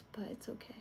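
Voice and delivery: whispering. Real, not acted. Muttering to herself while choosing music.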